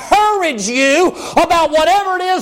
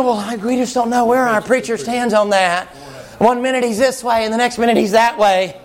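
a lower, dismissive church member's whine; lightly voiced doubtful congregant; mocking a doubter; 5 semitones lower than usual. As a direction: sneer it like a fickle pew-sitter. Congregant mocked for doubting the preacher.

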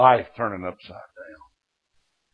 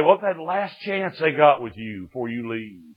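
ordinary talk

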